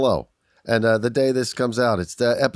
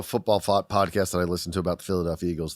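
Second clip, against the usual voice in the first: normal talking voice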